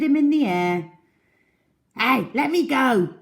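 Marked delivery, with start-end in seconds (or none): 2.0-3.1 higher, louder protesting yell. A narrator's reading. Crocodile dangling from the trunk, yelling. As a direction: cry out to be let down. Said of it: "yelled the enormous crocodile".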